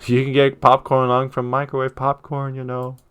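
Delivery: put-on high-pitched kid calling after an adult. Ernest calls after the adult walking back inside, high kid's call. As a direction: raise the voice into a high child's call. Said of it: "Ernest calls after me".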